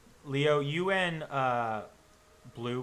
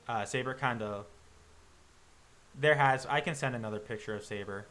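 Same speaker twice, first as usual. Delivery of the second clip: usual speaking voice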